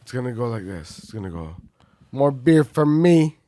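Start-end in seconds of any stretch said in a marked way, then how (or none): 2.1-3.4 a loud, slowed gruff friend voice; Godzilla impression. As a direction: boom it out like the absent buddy. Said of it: "gonna do this in the spirit of Godzilla".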